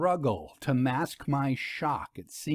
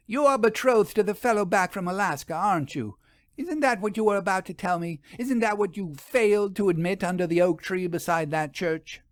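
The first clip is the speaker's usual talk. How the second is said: higher, fast insistent questioning; pressing accusation; 7 semitones higher than usual. A narrator's reading. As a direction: rush the questions, pushing for a confession.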